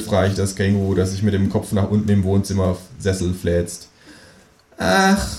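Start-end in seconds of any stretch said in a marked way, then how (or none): 4.8-5.3 high, dismissive kangaroo sigh-word; bored kangaroo. Acted. Kangaroo waves off the narrator, high bored Ach. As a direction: wave it off as the kangaroo. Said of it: "winkt gelangweilt ab".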